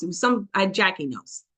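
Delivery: usual speaking voice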